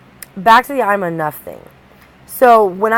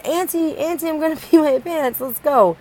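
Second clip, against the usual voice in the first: high, urgent little-girl voice, lightly voiced; little niece voice; 8 semitones higher than usual. Aunt imitating her niece begging to rush to the toilet, in a high little-girl voice. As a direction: play the three-year-old who needs the bathroom now. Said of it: "she'd start doing the pee-pee dance".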